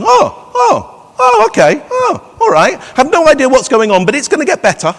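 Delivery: chirpy optimistic inner voice rising upward; upbeat inner voice. Retold or imitated. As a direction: voice the hopeful little voice in their head. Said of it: "maybe it's got an upward inflection to it".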